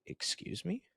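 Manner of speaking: high, incredulous "excuse me" in a retelling; shocked, can't believe what he's hearing; stunned disbelief. Retold or imitated. Retelling the boat owner's accusation.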